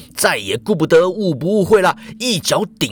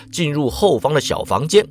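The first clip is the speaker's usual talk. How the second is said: ordinary delivery, nothing marked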